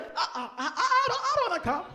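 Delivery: high, laughing, stammering no; laughing refusal. Pretend. Preacher voicing herself refusing heaven, high giggling protest. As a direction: giggle out a playful refusal, pitch way up. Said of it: "And she's like".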